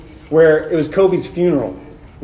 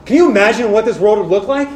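higher, louder, driving preacher's voice; full-force preaching; 7 semitones higher, 4 dB louder than usual. Acted. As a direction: preach it out to the room, rising and urgent.

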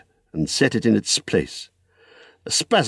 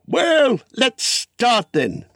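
very high, drawn-out cheer; excited send-off; much slower than usual. Acted. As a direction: launch the journey with delight. Bilbo sets off.

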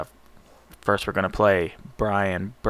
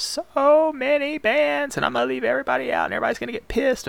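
high squeaky voice; 3 dB louder, faster than usual. Pretend. Mocking angry bands.

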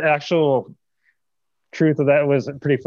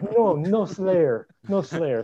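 put-on slow, higher French-accented neighbor; 7 semitones higher, much slower than usual. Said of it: "she had this heavy, like, French accent".

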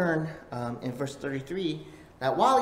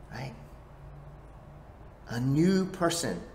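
usual speaking voice

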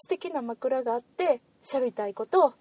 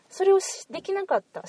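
ordinary delivery

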